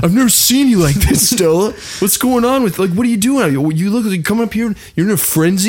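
high, strained worried husband; husband pressing his frantic wife, high and strained; [name]'s high voice. Acted. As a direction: keep the pitch way up, flustered. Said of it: "That's his voice. I like it".

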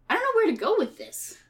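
normal talking voice

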